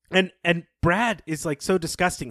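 ordinary talk

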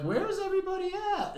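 high puzzled question. Retold or imitated. Girlfriend looking around, retold.

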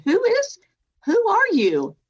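high loud frightened question; high loud scared demand; startled fear. Retold or imitated. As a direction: call out into the dark, alarmed. Hearing a mysterious voice at night.

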